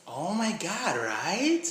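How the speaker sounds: Miami accent, high and sing-songy with stretched vowels. Shown as a demonstration. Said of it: "something from Miami might sound like".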